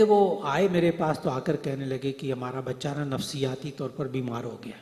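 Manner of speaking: ordinary delivery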